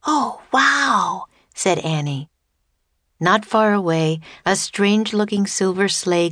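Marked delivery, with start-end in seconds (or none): 0.1-1.3 high-pitched, amazed exclamation; amazed girl's "oh, wow" in a storybook read; awed wonder. Acted. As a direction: marvel at it in a higher voice. Child amazed at the magic sleigh.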